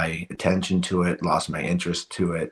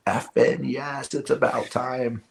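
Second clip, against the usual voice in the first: mild mock yell, higher and slow; drawn-out, raised-pitch retold cheer; retold scream; 6 semitones higher, slower than usual. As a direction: imitate a triumphant yell without the volume. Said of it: "I yelled at the top of my lungs".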